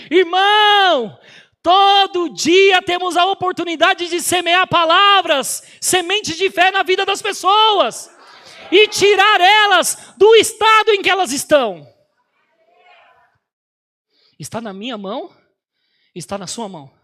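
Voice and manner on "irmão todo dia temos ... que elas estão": slow, building to a shout; preaching at full force. Acted. Preacher exhorting the congregation, building to a shout. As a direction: drive the sermon point home, rising.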